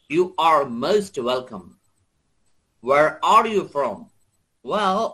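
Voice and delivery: slow, deliberate American English; American accent. Shown as a demonstration. Teacher reads a dialogue for students, American accent. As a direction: read it with American r's. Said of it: "এটা ছিল American accent".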